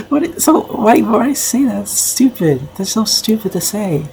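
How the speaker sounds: baffled disbelief, calling it stupid; exasperated. Real, not acted. Reacting to the clip's remark.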